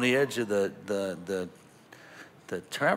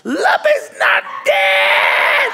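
very high, slow, drawn-out shriek; hammed-up ecstatic wail; triumphant scream; 14 dB louder, much slower than usual. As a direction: scream the good news at full volume. Announces the dog revived.

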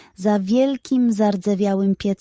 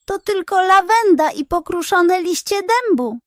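higher, louder detective character voice; detective's voice; 9 semitones higher, 4 dB louder than usual. Acted. Detective exposes the fraud to villagers, higher louder accusing voice. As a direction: voice the pig detective, confident and accusing.

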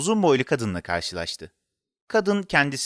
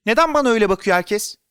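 put-on high, raised, anxious child's question; frightened; 8 semitones higher, 5 dB louder, faster than usual. A narrator's reading. Boy stared at by everyone, frightened. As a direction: ask in rising panic. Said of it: "gerçekten korkuya kapıldı".

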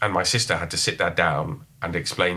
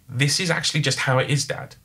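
retold exasperation; 7 semitones higher than usual. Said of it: "stressful, hair pulling out".

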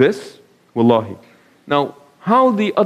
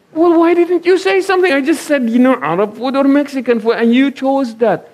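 much higher, incredulous wife's complaint; exasperated wife; 9 semitones higher than usual. Retold or imitated. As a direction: throw it back at him, pitched high in disbelief.

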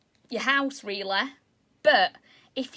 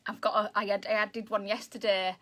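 usual speaking voice

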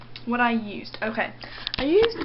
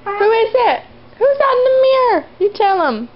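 very high, loud squeal at the dog; excited pet talk; 11 semitones higher, 10 dB louder than usual. Real, not acted. Owner hypes her dog at his reflection, squealing high. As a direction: squeal it up to get the dog going.

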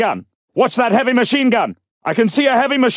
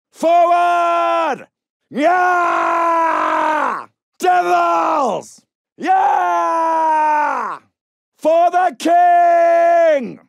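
battle cry; 4 semitones higher, much slower than usual. Acted. Soldiers' battle cry charge.

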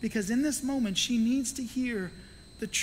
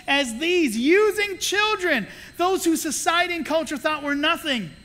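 indignant insistence; 6 semitones higher, 3 dB louder than usual. Retold or imitated. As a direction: insist firmly on the children. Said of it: "he was like".

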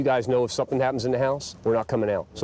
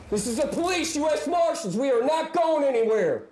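police callout; 13 semitones higher than usual. Real, not acted. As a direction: project it up into the attic. Calling up into the attic.

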